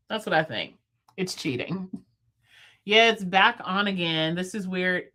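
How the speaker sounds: normal talking voice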